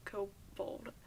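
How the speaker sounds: whispered to self. Real, not acted. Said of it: "rereads clue under breath".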